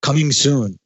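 lightly voiced trailer announcer; a hint of movie-trailer voice-over; mock movie promo read. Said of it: "certain movie voice actors come on and say".

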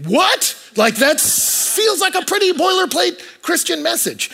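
high-pitched, drawn-out disbelief; mock incredulity. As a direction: react in squeaky, exaggerated bafflement. Mock reaction to a basic message.

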